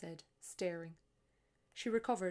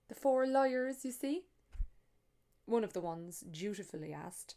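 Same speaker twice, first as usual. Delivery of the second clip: story narration; 2 semitones higher, 6 dB louder than usual. A narrator's reading. Author reading her novel to an audience, lively storytelling. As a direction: read it to the room like a storyteller.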